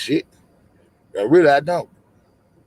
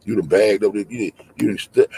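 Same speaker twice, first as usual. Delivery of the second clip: usual speaking voice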